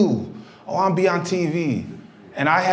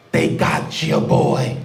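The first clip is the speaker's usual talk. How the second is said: tough guy on the phone; much slower than usual. Retold or imitated. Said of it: "all I said was".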